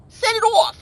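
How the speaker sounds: high, loud New York cheer; New York accent. Acted. Shout out to [name], high loud New York accent. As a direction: call it out like a New Yorker. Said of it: "in my New York accent".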